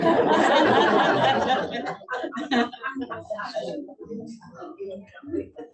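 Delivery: long, high, real laughter. Real, not acted. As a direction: laugh it out freely. Laughing after telling the recipe joke.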